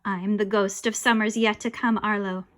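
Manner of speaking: usual speaking voice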